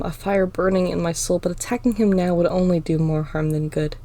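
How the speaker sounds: plain reading voice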